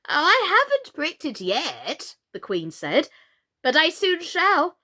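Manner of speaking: put-on high, fussy old queen voice; flustered queen, pitched well up.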